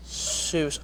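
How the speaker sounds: normal talking voice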